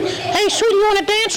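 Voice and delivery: very high flirty puppet with a laugh. Acted. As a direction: chirp a cheeky pickup line. Dummy flirts again.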